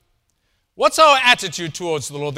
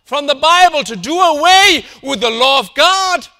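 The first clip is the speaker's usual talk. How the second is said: preaching at full force; 6 semitones higher, 9 dB louder than usual. Acted. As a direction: thunder it from the pulpit, building. Preacher builds to a climax.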